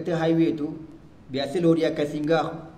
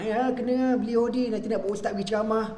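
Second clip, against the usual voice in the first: friend's proud voice; 7 semitones higher than usual. Retold or imitated. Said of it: "Dia kata".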